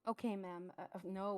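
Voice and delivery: usual speaking voice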